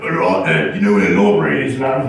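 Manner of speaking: low, fast, rough Cockney voice; Cockney accent. Retold or imitated. Nervous guy asking girls for the library, Cockney accent. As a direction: drop it low and fast like a London geezer. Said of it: "even if you use the Cockney accent".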